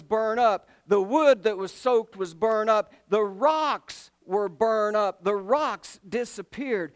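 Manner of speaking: loud, emphatic pulpit delivery; full-force preaching. Acted. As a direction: drive each phrase home like a preacher.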